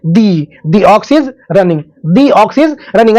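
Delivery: slow demo with "the" said as long "dee" before ox; dee before vowel. Shown as a demonstration. Teacher demonstrating to class: "the" as "dee", slowly. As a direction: stretch "the" into "dee" and read slowly. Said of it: "vowel के पहले आता है तो क्या पढ़ते हैं?".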